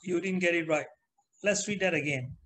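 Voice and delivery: ordinary delivery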